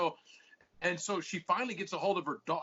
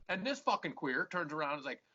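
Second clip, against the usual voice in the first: usual speaking voice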